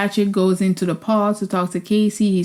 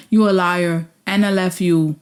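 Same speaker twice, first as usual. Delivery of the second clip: ordinary talk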